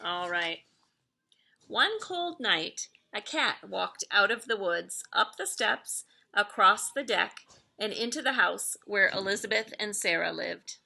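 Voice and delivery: usual speaking voice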